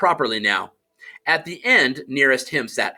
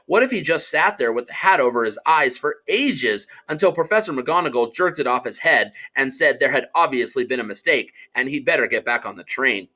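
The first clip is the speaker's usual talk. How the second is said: ordinary delivery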